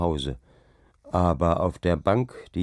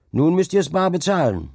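high, raised-voice taunt; heckling; 8 semitones higher, 4 dB louder than usual. A narrator's reading. Villager telling drinking companions they must pay, heckling. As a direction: jeer it at them, pitched well up. Tavern quarrel over the dike-master.

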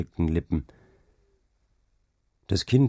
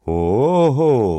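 loud, raised, drawn-out shout; calling out; 5 semitones higher, 9 dB louder than usual. A narrator's reading. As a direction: holler it out long. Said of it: "rief Hauke". (lauter, gedehnter Ruf)

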